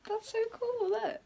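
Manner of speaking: very high, giddy squeal of delight; delighted excitement. Real, not acted. Vlogger showing viewers the new tripod, excited. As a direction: marvel in a high little voice.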